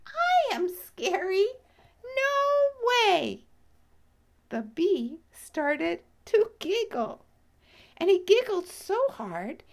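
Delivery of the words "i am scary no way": higher, frightened little bee; a tiny bee, startled and scared. Acted. Bee character speaking.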